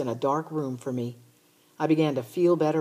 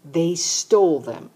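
slow, louder, near shout; exasperated near-shout at sister; almost shouting; 2 semitones higher, 7 dB louder, slower than usual. Acted. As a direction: bite off each word, fed up. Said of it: "almost shouting".

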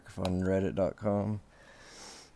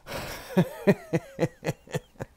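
real high laugh; 10 semitones higher than usual. Real, not acted. Vlogger to viewers, cracking up at the juicy gossip, high breathy laugh. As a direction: burst of breathy giggling, pitched high.

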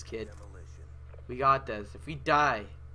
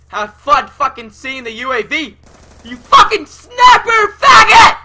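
high, loud furious scream; screaming rage; 9 semitones higher, 18 dB louder than usual. Real, not acted. Gamer screaming insults at the enemy player, furious high scream. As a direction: shriek the insult at full rage.

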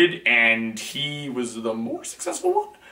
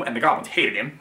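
ordinary talk

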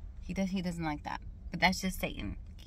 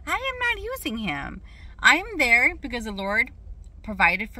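indignant denial; 4 semitones higher, 3 dB louder than usual. Real, not acted. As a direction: pitch leaping high in protest. Indignantly denies an accusation.

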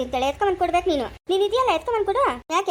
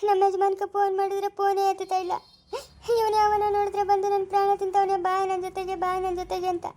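tearful crying. Acted. New mother telling her sister-in-law her troubles, crying. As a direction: lament through sobs. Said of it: "ಅಳ್ಬೇಡ ಸುಮ್ಮನೆ ಇರು".

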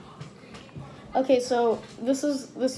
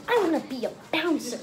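high voice; 5 semitones higher than usual. Retold or imitated. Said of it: "his voice is really high".

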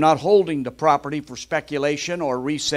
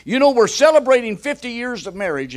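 higher, louder proud announcement; proud husband; 9 semitones higher, 7 dB louder, faster than usual. Retold or imitated. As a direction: boast about fifty years, pitched up. Husband telling genie.

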